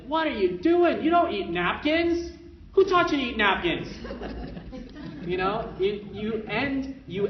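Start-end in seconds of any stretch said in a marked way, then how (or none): 0.1-3.9 high, loud mock scolding; hammed-up alarm at the napkin eater; exasperated scolding parent. Parent catching toddler eating a napkin, mock exasperated. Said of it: "turn something that's like". 3.9-5.2 breathy laugh. Real, not acted. Laughs after mock scolding.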